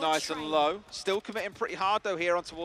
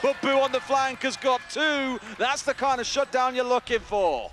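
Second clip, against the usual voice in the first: excited play-by-play; 7 semitones higher, 6 dB louder than usual. Real, not acted. Caster calls a shutdown.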